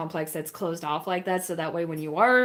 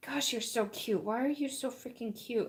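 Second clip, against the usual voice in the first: soft, tender coo; 3 semitones higher, 8 dB quieter than usual. Real, not acted. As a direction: melt over how cute the dog is. Cooing at cuddly puppy.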